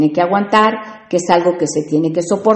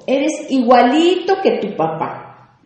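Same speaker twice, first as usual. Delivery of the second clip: slow, higher, contemptuous jab; scornful tone; 6 semitones higher, slower than usual. Shown as a demonstration. Parent scolding the child, contemptuous. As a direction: sneer it like an insult. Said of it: "tiene un tono despectivo".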